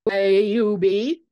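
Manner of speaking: loud, slow, booming greeting; a big bellowed welcome; bellowing. A narrator's reading. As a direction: boom it out across the room. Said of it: "Andrew bellowed".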